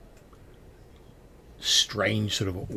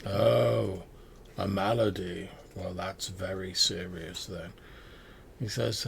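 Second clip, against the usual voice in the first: ordinary talk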